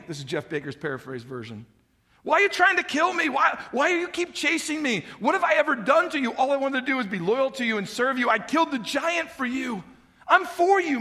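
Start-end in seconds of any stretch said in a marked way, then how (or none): 2.2-11.0 pleading shout. Retold or imitated. David pleading with Saul.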